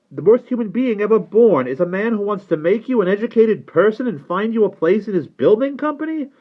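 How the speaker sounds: high-pitched, heated berating; exasperated father. Acted. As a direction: plead and scold with mounting exasperation. Father berates nephew in quarrel.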